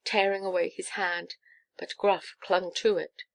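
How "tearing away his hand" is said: ordinary delivery, nothing marked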